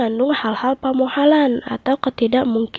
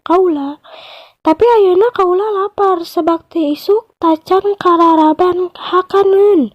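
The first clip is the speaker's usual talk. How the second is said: higher, wheedling crocodile voice; sly crocodile; 6 semitones higher than usual. Acted. Crocodile tells the buffalo he wants to eat him, higher sly voice. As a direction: coax him, then reveal the hunger. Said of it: "cek buaya".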